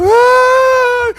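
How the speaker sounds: high, loud mock wail; wailing cry. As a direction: wail like the crying wife on the phone. Crying wife on phone.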